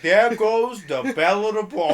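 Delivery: loud, high mocking catcall; leering prisoner. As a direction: tease the newcomer like a prison bully. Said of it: "The fucking prison mic".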